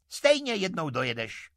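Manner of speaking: high, loud, cutting cry; sharp fairy shout. A narrator's reading. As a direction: shout it like an angry fairy's threat. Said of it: "křikla ostřicovým hlasem víla". (výkřik víly ostřicovým hlasem)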